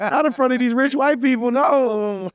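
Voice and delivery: high, slow, squeaky mock panic; hammed-up high fearful protest to himself; mock panicked inner voice. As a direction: play your own frightened inner voice, way up high.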